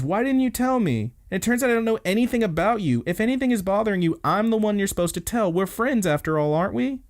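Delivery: high, rushed, pleading reproach; hurt confrontation. Retold or imitated. Retold friend's hurt confrontation.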